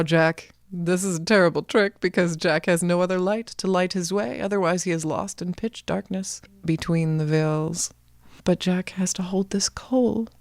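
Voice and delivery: ordinary delivery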